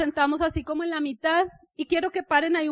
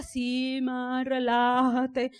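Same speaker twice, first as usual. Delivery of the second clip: slow, lower, drawn-out easygoing voice; lazy relaxed son; 4 semitones lower, much slower than usual. Retold or imitated. Calm son answering his rushing mother, slow and lazy. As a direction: laze through it like nothing matters. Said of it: "el flemático, relajado, melancólico".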